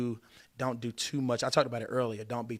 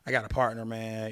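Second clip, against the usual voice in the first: ordinary talk, nothing marked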